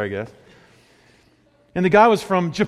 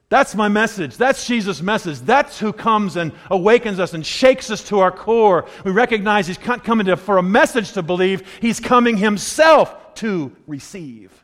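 raised, driving pulpit delivery; impassioned sermon climax; forceful preaching; 5 semitones higher, 4 dB louder than usual. Real, not acted. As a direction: preach it hard, punching key words.

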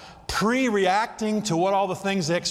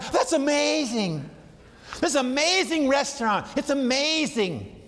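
mock gushing; 7 semitones higher than usual. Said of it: "everything's so amazing that nothing's amazing".

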